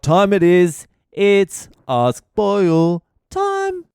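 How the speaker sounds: big rising showman call, slow and loud on the title; segment announcement. Acted. Host hyping up his listeners for the segment, drawn-out announcer call. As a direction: hype up the segment like a game show host.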